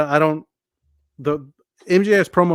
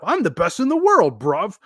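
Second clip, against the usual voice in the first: high, hammed-up British boast; mock British cockiness, pitched way up; really bad British accent; 7 semitones higher, 4 dB louder than usual. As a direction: brag in a badly faked British accent. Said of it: "really bad British accent".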